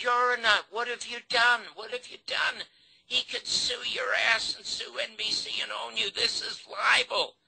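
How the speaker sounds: high-pitched, agitated rant; retold furious screaming. Producer telling off the show's owner over the edit, retold scream. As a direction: reenact an outraged tirade, pitched well up. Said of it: "And I screamed at him".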